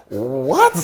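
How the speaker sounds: loud, high, incredulous yelp; big acted-out double take; flabbergasted. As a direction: burst out in mock disbelief. Retold reaction to referee's absurd instruction.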